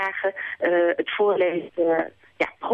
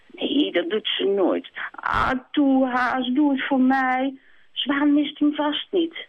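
higher, slow coaxing hen; pleading hen; 7 semitones higher than usual. Acted. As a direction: drag out the begging. Kip begging.

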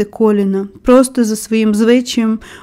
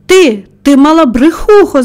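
higher, louder outburst at the culprit; angry yell; 5 semitones higher, 6 dB louder than usual. A narrator's reading. Old man scolding a small bird, angry yell. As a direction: yell the accusation, acting it out. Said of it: "заволав він".

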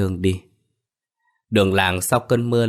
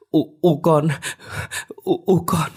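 much higher, stammering acted cry; shocked, distraught; 9 semitones higher than usual. A narrator's reading. Soan learns her mother drowned, distraught high stammer. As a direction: choke on the words in shock.

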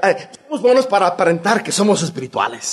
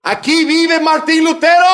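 higher, louder call through the door; shouting at the door; 8 semitones higher, 5 dB louder than usual. Retold or imitated. Crowd at Luther's door shouting, mock call. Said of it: "Estaban gritando".

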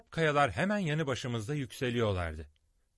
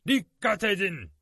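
high, loud, slow warning; shout; 10 semitones higher, 8 dB louder than usual. A narrator's reading. Lord John warning of the flying creature, loud shout. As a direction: yell a warning as you duck. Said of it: "diye bağırdı Lord John".

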